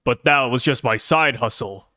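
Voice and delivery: suddenly cool, suave villain; deep cool voice. Retold or imitated. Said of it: "code switches later on".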